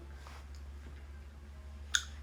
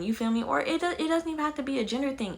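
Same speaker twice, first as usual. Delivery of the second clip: ordinary delivery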